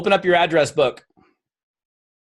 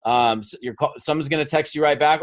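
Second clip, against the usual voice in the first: ordinary talk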